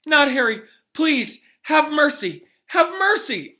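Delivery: high, loud, desperate begging; pleading scream. Acted. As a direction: beg for the child's life, screaming. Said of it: "The woman was screaming".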